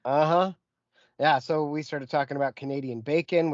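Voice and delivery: ordinary delivery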